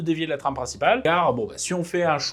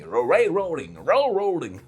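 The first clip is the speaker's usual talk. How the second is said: railroading said the English way, with an English accent, a rolled-back English r; slower than usual. Shown as a demonstration. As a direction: say railroading with a crisp English accent, as a native would. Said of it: "ça va encore mal parler dans les commentaires sur l'accent anglais". (railroading prononcé avec un accent anglais appuyé)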